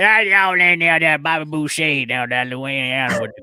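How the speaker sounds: garbled mock Louisiana country accent; Farmer Fred. Host trying a second Louisiana accent, hammed-up farmer. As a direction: run words together in hammy bayou farmer talk. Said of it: "So I do like Farmer Fred".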